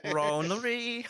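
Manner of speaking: high, loud mock Korean accent; the Team America "ronery" line, pitched high. Team America Kim quote.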